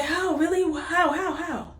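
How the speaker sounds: put-on a little boy who can't believe the good news; amazed boy. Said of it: "he was like".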